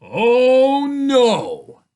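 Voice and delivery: loud, high, drawn-out wail of refusal; horrified father. A narrator's reading. Father sees the cart of ice cream, horrified cry. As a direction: wail it in dismay.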